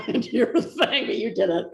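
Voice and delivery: laughing through the apology; real laughter breaking into the words. Real, not acted. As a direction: giggle out an embarrassed sorry. Laughing while apologising for interrupting.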